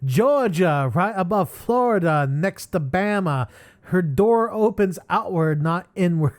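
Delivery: put-on loud, high, drawn-out Southern accent. Host retrying the Georgia line, hammed-up Southern accent. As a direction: lay on a slow, hammed-up Southern drawl. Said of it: "put on my, my southern accent".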